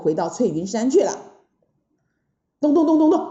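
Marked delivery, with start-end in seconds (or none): 2.6-3.3 loud, high knocking sound effect; urgent storybook knock-knock; imitated door knocking. A narrator's reading. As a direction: voice a quick pounding on the door.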